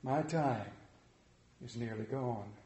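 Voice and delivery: quiet, weary phantom; fading ghost. Acted. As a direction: let the ghost's voice fade away.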